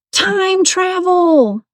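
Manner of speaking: high, drawn-out far-off call; imitated loud call. Retelling a woman's voice heard across the way, imitated loud call. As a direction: call it out long and high, without real volume. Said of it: "like, really loud".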